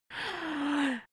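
high gasp of dread; wincing reaction gasp; squeamish gasp. Real, not acted. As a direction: suck in breath at the gore. Reacting to the cheese grater.

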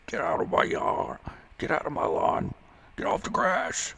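put-on high, strained cranky old-man voice; hammed-up crank chasing kids away; grumpy old-timer.

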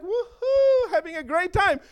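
high, exuberant whoop; gleeful whoop. Acted. Eagles gliding happily above the chickens, gleeful.

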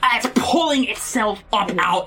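normal talking voice

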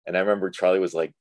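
ordinary talk

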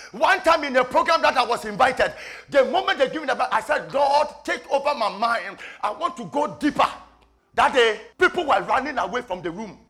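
high, impassioned testimony; fervent preaching. Real, not acted.

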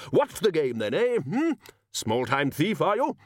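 high, challenging wizard interrogating a suspect; blustering Arch-Chancellor. Acted. Arch-Chancellor questioning a small-time thief, blustering.

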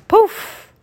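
very high, bright pop; magic poof. Acted. Magic sound effect word.

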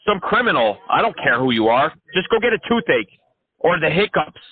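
high, excited ranting crank; manic caller. Acted. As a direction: rant like an unhinged crank caller. Crank caller rants at scammer.

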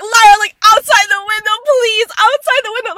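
high, loud urgent pleading; begging. Retold or imitated. Friend begging drunk Lara to puke out the window, pleading loudly. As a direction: beg her desperately to lean out. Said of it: "like, begging her".

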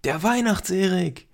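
höher und lauter in den Raum rufen; Schrei. Acted. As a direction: aufgeregter Kinderruf. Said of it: "schrie er in den Raum". (aufgeregt in die Küche geschrien)

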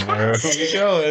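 asks while laughing, voice up high and slowed; a real laugh breaks through the words. Real, not acted. Husband laughing at his wife's overshare, asking through laughter.

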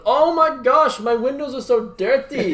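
high, loud imitation of a Russian lady's accent. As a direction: put on the Russian lady's accent, pitched well up. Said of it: "Great Russian accent".

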